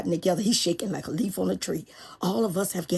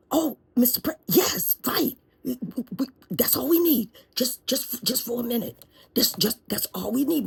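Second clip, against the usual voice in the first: high, eager, relieved; eager relieved lawyer; 5 semitones higher than usual. Acted. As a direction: gush with relief. Relieved lawyer thanks president.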